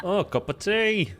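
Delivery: drawn-out tea in a put-on British accent; a British accent that drifts Australian; mock British accent. Shown as a demonstration. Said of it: "that just went a little Australian".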